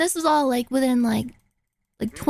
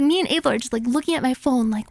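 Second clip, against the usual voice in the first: ordinary talk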